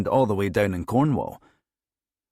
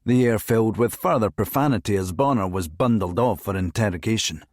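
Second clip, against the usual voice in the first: ordinary delivery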